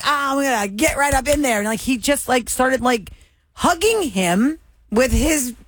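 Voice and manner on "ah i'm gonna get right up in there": high, playful retold quip; joking. Host retelling the joke about the hug, playfully high. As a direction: act out the joke in a raised voice. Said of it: "And then he joked".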